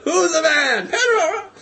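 high, loud mock cheering; retold stadium chant; screamed call-and-response. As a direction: imitate hero and crowd hollering. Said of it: "And he's screaming out".

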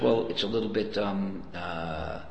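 a lightly voiced God, stammering quietly; God hedging softly to Moses; hesitant God. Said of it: "God says".

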